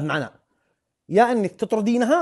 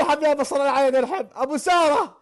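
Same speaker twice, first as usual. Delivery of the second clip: excited welcome; 8 semitones higher than usual. Real, not acted. Greeting player loudly with joy.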